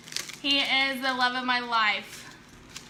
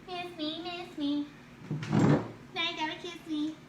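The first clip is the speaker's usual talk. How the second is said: teasing singsong; 5 semitones higher than usual. Real, not acted. Teasing husband who threw ice.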